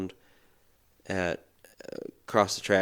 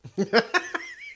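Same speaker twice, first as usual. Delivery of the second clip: real high-pitched giggle; laugh. Real, not acted. Storyteller cracking up at own impression, high laugh.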